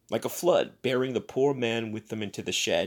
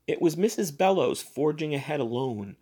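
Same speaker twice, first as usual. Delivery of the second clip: ordinary delivery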